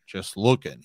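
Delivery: ordinary talk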